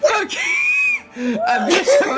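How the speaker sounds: laughing shout. Real, not acted. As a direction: crack up while yelling the words. Laughing hard about the clip.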